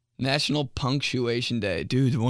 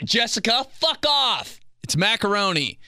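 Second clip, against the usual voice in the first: mock argument; 10 semitones higher than usual. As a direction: argue it back way up high.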